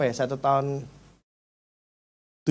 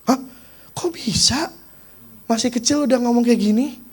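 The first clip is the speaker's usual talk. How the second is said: high, astonished voice; nurses' surprise; 9 semitones higher than usual. Retold or imitated. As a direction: gasp in disbelief as a nurse. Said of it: "suster semua pada bingung".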